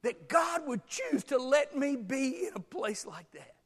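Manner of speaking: loud, high, slow shouted preaching; preaching at full force. Acted. Preacher pressing congregation on how long, shouting. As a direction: drive it home to the back row.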